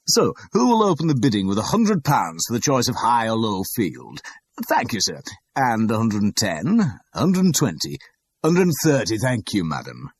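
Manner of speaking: loud, high, grandly pitched sales patter; showy auctioneer. A narrator's reading. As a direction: work the room like a salesman. Auctioneer taking bids.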